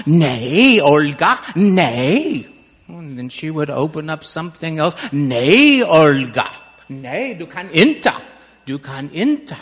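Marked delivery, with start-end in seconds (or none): 0.1-2.5 loud, sharp telling-off in Swedish; scolding. Acted. Great-grandmother scolds curious Olga. 5.1-9.6 loud Swedish reprimand, then settling into firm insistence; scolding, easing off. Acted. Great-grandmother forbids Olga, acted.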